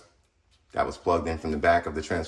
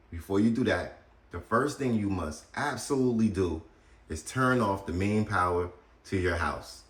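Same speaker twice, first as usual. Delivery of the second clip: ordinary talk, nothing marked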